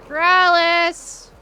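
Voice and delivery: long loud drawn-out call. Real, not acted. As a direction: shout his name across the map. Calling out for neighbor.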